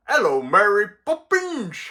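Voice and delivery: high, hammed-up Cockney greeting; fake Cockney accent. Shown as a demonstration. Chimney sweep greeting Mary Poppins, in mock Cockney. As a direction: call out in a badly faked Cockney accent. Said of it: "doing a fake Cockney accent".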